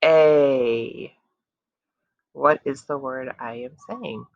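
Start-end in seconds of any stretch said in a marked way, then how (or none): 0.0-1.1 slow stretched vowel sound; drawn-out teaching sound; stretched slow sound again. Shown as a demonstration. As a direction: hold the vowel long, calm and even. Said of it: "say some words like a turtle".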